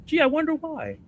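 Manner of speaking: high mock-wondering sarcasm; sarcastic. Real, not acted. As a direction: act puzzled while rolling your eyes. Sarcastic aside about obvious answer.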